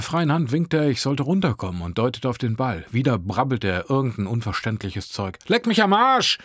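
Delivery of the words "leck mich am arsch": high, loud insult; shouted. A narrator's reading. Teen yells at boy before slamming window, shouting. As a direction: yell it out as a final word. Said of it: "schrie ich".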